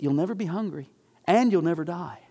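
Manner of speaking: ordinary talk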